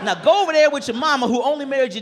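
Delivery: comic scolding a boy for his bad joke, loud mock rage; mock furious roast. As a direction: tear into the kid, over the top.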